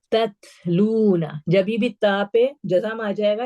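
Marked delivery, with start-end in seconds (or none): 0.1-1.2 slow تتلون with breath after the first closed T; drawn-out "tat-loona" with flowing T; hams. Shown as a demonstration. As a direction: recite تتلون slowly, airy T, long "loo". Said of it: "اس میں ہمس کی sound آئے گی".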